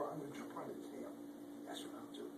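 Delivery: fast whispered plan; sneaky quick whisper. Acted. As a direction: rush it in a whisper.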